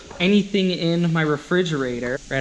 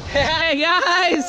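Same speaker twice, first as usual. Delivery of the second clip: excited greeting; 12 semitones higher, 4 dB louder than usual. Real, not acted. Greeting hosts loudly.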